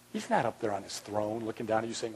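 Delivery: ordinary talk, nothing marked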